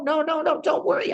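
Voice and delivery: acted reassurance. Yitzchak voiced, hypothetical reply.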